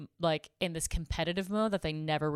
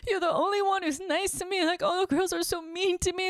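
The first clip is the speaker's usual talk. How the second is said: very high, put-on plaintive voice; imitated tearful complaint; 13 semitones higher than usual. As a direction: mimic the upset new girl pouring it out. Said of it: "just started crying and telling me all this stuff".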